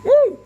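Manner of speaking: a high, loud cheer of delight; excited whoop. Real, not acted. Showing the phone camera to viewers, a delighted high whoop. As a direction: whoop like the trick just worked.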